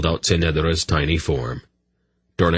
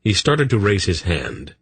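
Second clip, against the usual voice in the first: usual speaking voice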